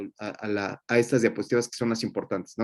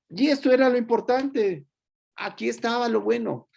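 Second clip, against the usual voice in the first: emphatic; 9 semitones higher than usual. Real, not acted. Teacher stresses the key slides.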